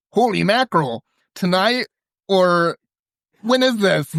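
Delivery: high-pitched, excited questions; astonished dad. Acted. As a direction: act out a dad amazed by the news. Dad reacting to the caller's news.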